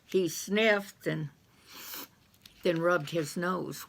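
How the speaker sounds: usual speaking voice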